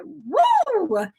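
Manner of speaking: high delighted whoop; excited woo. Retold or imitated. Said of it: "And I walked in and said".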